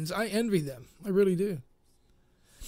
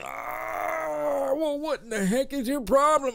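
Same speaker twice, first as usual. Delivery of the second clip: higher, put-on fed-up doctor; mock exasperated doctor; 8 semitones higher, slower than usual. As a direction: throw up your hands as the doctor.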